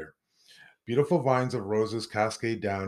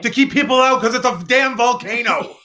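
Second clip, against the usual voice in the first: much higher and louder, mock outrage; mock indignant outburst; 11 semitones higher, 11 dB louder than usual. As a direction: blurt it out, way up and loud.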